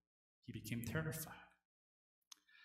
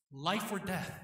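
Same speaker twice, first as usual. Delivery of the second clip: ordinary talk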